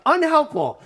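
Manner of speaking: high, loud sarcastic unhelpful; mock-exasperated one-word judgment; exasperated verdict. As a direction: spit the verdict out in disbelief. Deadpan verdict after laugh.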